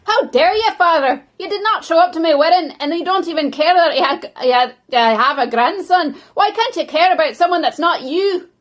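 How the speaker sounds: loud, high, indignant yelling; furious daughter. Acted. Daughter scolding her absent father, loud and high. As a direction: hurl it at him, outraged and hurt.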